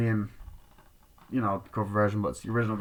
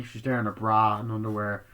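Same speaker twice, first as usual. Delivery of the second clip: ordinary delivery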